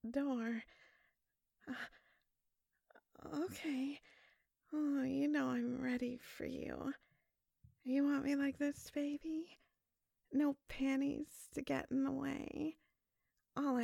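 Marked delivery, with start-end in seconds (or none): none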